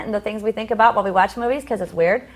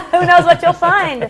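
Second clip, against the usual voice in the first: laughing, slow and louder; 6 semitones higher, 6 dB louder than usual. Real, not acted. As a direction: tease through laughter. Laughs at co-host's remark.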